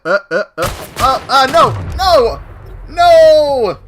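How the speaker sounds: building from uh to loud no; rising panic. Real, not acted. Dying in game.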